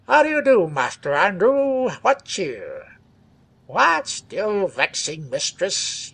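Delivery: mocking sneer, slow; contemptuous. A narrator's reading. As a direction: drawl it with scorn. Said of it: "he added contemptuously".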